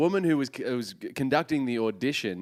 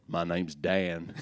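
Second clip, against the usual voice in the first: slow, low Texan drawl; put-on Texas guy, slow and deep; 9 semitones lower, 4 dB quieter, much slower than usual. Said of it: "so I'll be a Texan".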